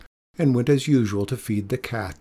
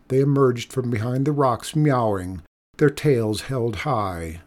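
ordinary delivery, nothing marked

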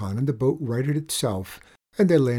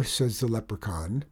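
ordinary delivery

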